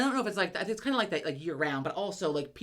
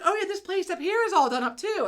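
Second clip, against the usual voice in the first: high, louder cooing admiration; pitched-up mock-innocent mom; delighted mom; 13 semitones higher, 5 dB louder than usual. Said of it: "And then Mom's like".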